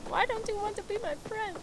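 high, pouty plea; put-on little rejected voice, pitched high; mock hurt pleading. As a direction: whine playfully at being snubbed. Mock complaint at being ignored.

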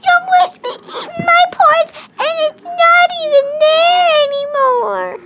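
put-on very high squeaky baby bear voice. Child narrator voicing the baby bear, very high and squeaky. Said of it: "the little baby bear went up and said".

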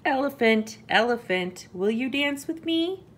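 high, eager mouse invitation; little mouse calling. A narrator's reading. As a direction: call the animal, then lift into the question. Mouse calls to an animal.

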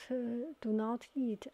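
ordinary talk

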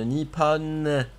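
slow "Nippon" with the opening N leaned on hard; stressed N. Shown as a demonstration. Teaching teammate the Japanese N, slow stressed "Nippon". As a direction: drag out "Nippon", pressing the N. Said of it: "faut bien l'appuyer".

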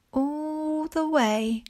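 ordinary talk

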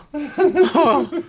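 nervous high laugh. Real, not acted. Scared of bees, reacts.

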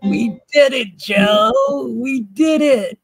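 high, slow mocking cheer; mocking Kamala. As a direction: imitate a giddy stoned cheer. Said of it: "she's like".

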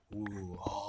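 slow whispered ghostly oooh; breathy slow cave howl imitation; eerie cave moan. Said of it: "กลัวเสียงถ้ำแม่งแบบ".

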